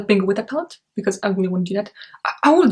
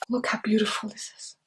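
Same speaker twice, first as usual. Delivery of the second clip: whisper; 8 dB quieter than usual. Real, not acted. Admiring the palette.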